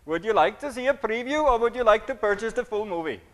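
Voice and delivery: mock Indian English accent. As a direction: put on an Indian accent for a YouTube prompt. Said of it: "Did I do the accent good".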